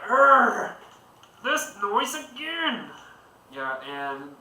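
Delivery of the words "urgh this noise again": high, fed-up groan then slow complaint; irritated groan. Acted. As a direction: drag out the annoyance. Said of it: "You're like".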